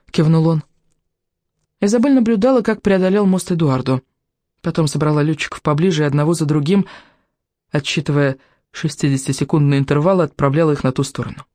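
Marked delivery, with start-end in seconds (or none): none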